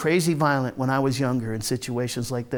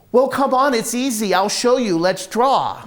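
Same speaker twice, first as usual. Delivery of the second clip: put-on higher, louder eager little girl; lightly voiced child coaxing cheerfully; 6 semitones higher, 3 dB louder than usual. Child coaxes him to draw.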